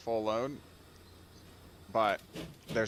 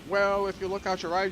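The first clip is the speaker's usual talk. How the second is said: higher, louder announcer restart; mock conductor announcement; 6 semitones higher, 4 dB louder than usual. Playing conductor to viewers, mock announcer. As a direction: redo it as the tour conductor. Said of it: "the whole conductor thing".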